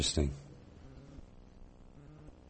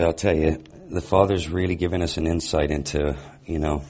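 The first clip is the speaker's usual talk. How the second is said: usual speaking voice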